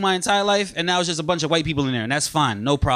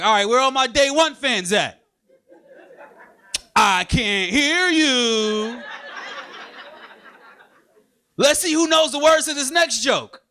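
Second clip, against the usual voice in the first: higher-pitched mock crowd call; concert hype; 5 semitones higher, slower than usual. Comic playing veteran act to day-one fans, mock concert hype. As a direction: work the crowd like a singer playing the hits. Mimics a musician working the crowd.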